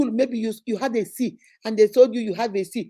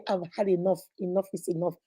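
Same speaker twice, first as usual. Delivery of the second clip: quiet resolve; 4 semitones lower, 6 dB quieter than usual. Acted. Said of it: "get up and say".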